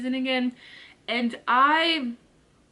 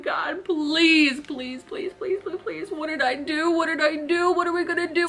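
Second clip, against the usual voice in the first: desperate praying; 4 semitones higher than usual. Retold or imitated. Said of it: "I was like".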